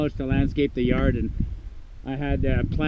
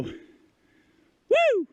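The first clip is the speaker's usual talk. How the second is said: excited whoop; 3 dB quieter than usual. Real, not acted. Hiker whooping at the view of the avalanche, thrilled. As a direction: let out a whoop at the sight.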